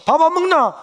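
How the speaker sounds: loud, high call to eat; mother calling again after a long wait. Retold or imitated. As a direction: holler it from the other room.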